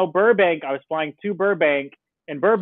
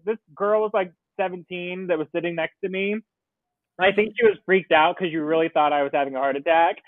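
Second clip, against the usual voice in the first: ordinary talk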